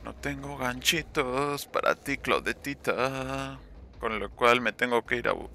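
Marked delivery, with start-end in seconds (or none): 0.0-3.6 slow, drawn-out mock sweetness; slow singsong taunt at Claudette; sing-song taunt. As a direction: stretch it out, teasing. Taunting a survivor.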